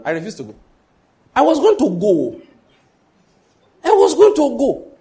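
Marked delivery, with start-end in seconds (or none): 1.3-2.4 emphatic declaration. Acted. Preacher repeats line building force. 3.9-4.9 high, loud preaching cry on going; emphatic shout. Acted. Preacher repeats line building force.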